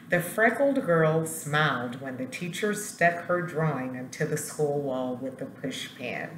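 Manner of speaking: ordinary delivery, nothing marked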